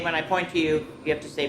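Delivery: plain reading voice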